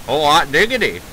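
put-on delighted old Hollywood mid-Atlantic exclamation; gushing mid-Atlantic accent. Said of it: "whip out that mid-Atlantic accent".

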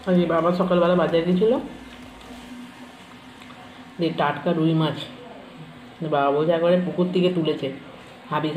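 speaking between bites; talking while chewing. Real, not acted. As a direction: mouth partly full. Seen 0: Hand to mouth at -7; closed-lip chewing visible at 1.4 and 7.4.

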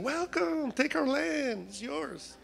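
high, soft singsong greeting; sugary make-believe hospitality; mock welcome. Imagines gushing welcome to promised land.

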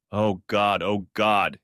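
groaning. A narrator's reading. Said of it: "he groaned".

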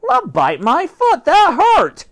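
high loud outraged protest; high angry dog voice; indignant yelp. Acted. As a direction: snap back, still stung.

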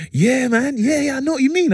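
gushing mum. Retold or imitated. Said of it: "My mum's fully smiling, grinning teeth".

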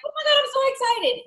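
very high thrilled squeal; squealing excited. Retold or imitated. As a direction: gush like an overjoyed client. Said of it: "people that are like".

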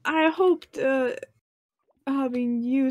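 ordinary delivery

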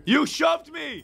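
high-pitched shout of protest; accusing cry. Real, not acted. Player blaming Melon for a shove, accusing cry. Accuses teammate after fall.

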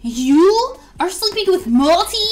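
high, loud, outraged; jealous outburst. Real, not acted. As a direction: yell it, betrayed and shocked. Jealous outburst at sleeping pair.

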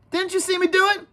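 high-pitched plea for a witness; eager appeal. Acted. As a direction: press the others to back you up. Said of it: "He felt the need for a witness".

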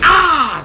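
high, loud "ah"; short battle cry on a hit; attack yell. Real, not acted. Hitting an opponent.

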